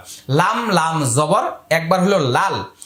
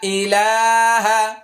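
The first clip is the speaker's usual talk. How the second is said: slow recitation; slower than usual. Shown as a demonstration. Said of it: "এবার মুখস্থ করে নিন".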